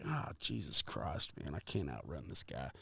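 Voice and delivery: retelling a hopeless mutter when the cop appears; muttered despair. Retold or imitated. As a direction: groan it quietly to yourself, defeated. Retold despair seeing cop behind.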